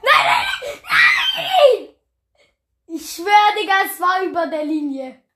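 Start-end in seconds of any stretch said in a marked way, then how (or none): 0.0-1.9 loud laughing cries of no; laughing shout. Real, not acted. Losing goal, laughing desperately.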